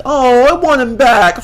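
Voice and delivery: high, loud whiny mimicry of Doug; high mocking whine; mocking whiny quote. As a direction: sneer it like a sulky kid. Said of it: "but now it's like".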